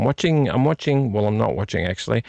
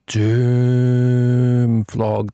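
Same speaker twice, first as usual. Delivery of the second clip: "Doom" sung out long and level; drawn-out DOOM; 4 dB louder than usual. Shown as a demonstration. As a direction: hold "Doom" for a long, sustained vowel before "Vlog". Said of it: "The correct way to say it".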